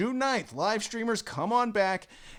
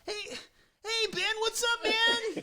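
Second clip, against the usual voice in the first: very high put-on friendly voice; Tim Tutton impression; slower than usual. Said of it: "your Tim Tutton imitation".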